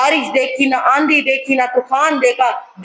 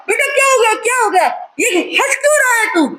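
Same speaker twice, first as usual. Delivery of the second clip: much higher, alarmed calling out; alarmed shout; 10 semitones higher, much faster than usual. Acted. Father to his laughing son, high alarmed shout.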